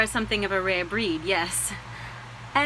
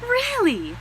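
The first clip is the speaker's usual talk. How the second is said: surprised. Acted. Delighted at shared university.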